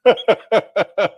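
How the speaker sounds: hearty laugh, real; burst of laughter. Real, not acted. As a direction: let the laugh roll. Laughing before moving on.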